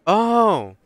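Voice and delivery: high, loud sudden oh; sudden realisation about the broken window; surprised realisation. Real, not acted. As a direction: it just clicked.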